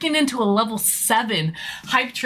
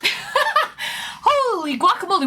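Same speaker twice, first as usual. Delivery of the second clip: laughing, slow and high; 6 semitones higher, slower than usual. Real, not acted. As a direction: laugh through the words. Laughing at a big gift.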